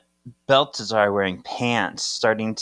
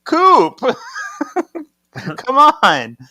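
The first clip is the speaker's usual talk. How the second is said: mock shout; 8 dB louder, much slower than usual. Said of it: "And Henry goes".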